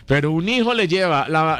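usual speaking voice

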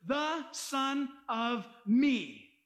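"The Son of Me." drawn out very slowly, each word weighted; slow emphasis. Shown as a demonstration. Preacher stresses the Greek meaning to the congregation, very slow. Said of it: "that's the original word is".

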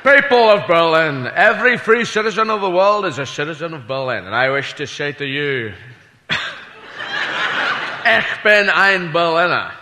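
put-on measured Scottish-accented oratory; Sean Connery. President tells the Berlin crowd he is one of them, put-on Scottish oratory. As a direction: deliver a grand speech in a put-on Scottish film-star voice. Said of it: "Sean Connery doing, uh, President Kennedy".

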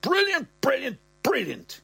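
put-on way up high, delighted old man; the old man, chuffed, in a high piping voice; old man's squeaky voice. Old dad to son, thrilled: high piping.

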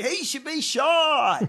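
loud, high, long-drawn mock holler; imitated crowd yell. Host predicting the crowd's reply, in a loud mock yell. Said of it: "they'd say".

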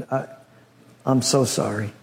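low, hesitant sorrowful apology; hesitate, then apologise softly; somber messenger. Acted. Messenger breaking news of the children to Job, hushed and sorry. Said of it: "comes in somber, looking very down".

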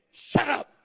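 forceful, sharp command to a devil; blunt rebuke. Acted. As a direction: silence it with one hard burst. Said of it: "we would say".

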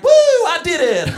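high, loud mock whoop; hammed-up triumphant woo; smug victory cheer. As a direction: gloat like a clueless winner. Imagined smug winner on stage.

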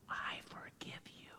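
soft whisper, almost breath; whispered forgiveness. Retold or imitated. God forgiving the child, whispered tenderly. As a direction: breathe it gently like a secret.

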